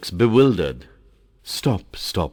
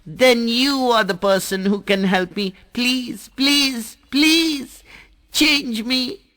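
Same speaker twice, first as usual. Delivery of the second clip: pleading, building from high to very high and loud; water drop begging the Mountain, rising with each please; excited; 4 dB louder than usual. Acted. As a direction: beg harder with each please. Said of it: "Jal, in excitement".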